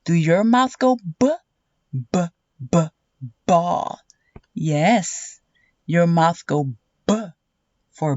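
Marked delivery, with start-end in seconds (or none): none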